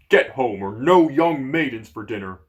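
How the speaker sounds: loud giant shout; giant threat. Acted. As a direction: bark the final threat.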